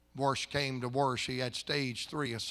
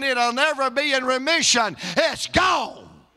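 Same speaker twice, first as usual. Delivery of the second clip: high, loud preaching, building to a shout; full-force preaching; 10 semitones higher, 8 dB louder than usual. Retold or imitated. Pastor retells wife insisting on healing, fervent shouted preaching. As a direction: preach the retold argument with rising fervor.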